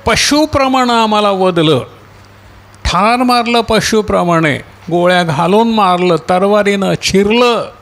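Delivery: martyrs' appeal, pitched high and sustained; crying out. Acted. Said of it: "मोठ्यानं ओरडून काय म्हणाले".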